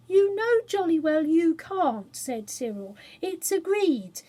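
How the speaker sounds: high, slow, firm telling-off; stern older brother. Acted. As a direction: lay down the rule, slowly. Cyril scolds Jane.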